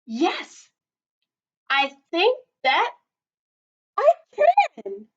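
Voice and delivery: Kevin, finally brave, answers the crowd; Kevin's reply. Acted. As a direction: gather courage through the line. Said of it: "Kevin replied".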